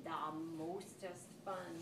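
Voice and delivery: low, soft, slow little voice; little kitten. A narrator's reading. Jujubee the youngest sister adding her bit, low and slow. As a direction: murmur it slowly, the sleepy smallest sister. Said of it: "added Jujubee".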